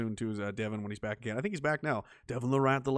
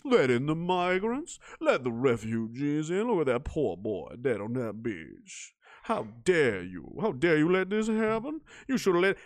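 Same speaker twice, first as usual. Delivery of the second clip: lower, slow, preachy mock voice; mock guilt-trip; 4 semitones lower, 3 dB quieter than usual. Mocking the media using a dead child, slow preachy mimicry. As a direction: drag it out like a sanctimonious newscaster.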